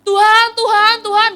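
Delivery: calling out. Acted. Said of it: "Tapi dia teriak".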